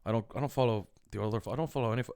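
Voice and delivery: usual speaking voice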